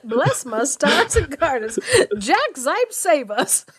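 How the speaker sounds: put-on a scandalised old biddy; pearl-clutching mother. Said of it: "friend joins the mother bit".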